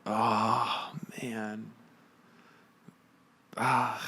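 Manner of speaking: ordinary talk, nothing marked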